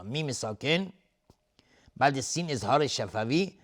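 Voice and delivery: ordinary talk, nothing marked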